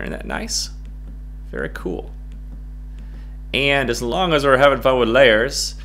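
ordinary talk, nothing marked

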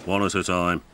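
firm. Acted. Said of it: "replied the Fat Controller".

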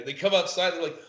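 ordinary talk, nothing marked

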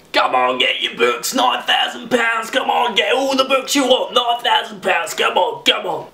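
high-pitched rapid-fire street seller's patter; market trader hawking. Acted. Trader calling to students to buy books, hawking loudly. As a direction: call it out like a barrow boy.

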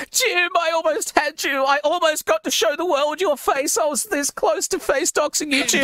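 high whiny mock of an emotional rant; mocking whiny Josh. Mocking Josh's high emotional rant at Jim. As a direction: whine it high like a hurt villain.

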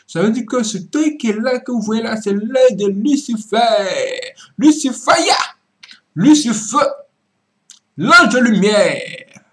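impassioned preaching. Real, not acted. Preacher exposing the eye of Lucifer to listeners, impassioned preaching. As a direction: preach it at full force, ringing out each title.

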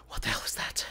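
hushed, voiceless whisper for the curious bug; whispered mantis voice. Retold or imitated. Buddy mantis smelling the bug spray, whispered. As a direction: breathe it as a wary creature sniffing the air. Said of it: "He's like".

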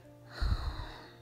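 breathy, wordless sigh; heavy sigh. Acted. Dragon speaking of a long-shirked duty, heavy sigh. Weary monologue on judging, duty.

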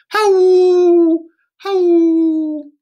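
high, loud drawn-out howl; wolf howl. Shown as a demonstration. Reader showing kids how coyotes howl, loud and high. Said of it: "practicing your best howl. Can you go".

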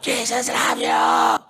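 slow, drawn-out strained shout; screamed out. Retold or imitated. Man on the street obeys Holy Spirit, screaming out. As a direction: imitate a man yelling it down the street. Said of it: "he screamed out".

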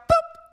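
squeaky high boop; high boop shout. Acted. Teapot song shout.

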